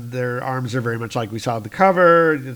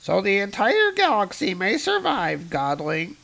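higher, patient alien; alien cultist voice; 8 semitones higher than usual. Acted. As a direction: answer calmly. Alien answers.

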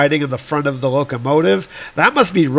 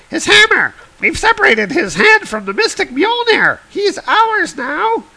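much higher, cartoonish trolls cheering; gleeful troll voices. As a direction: squeal it like greedy little trolls. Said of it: "And they're like".

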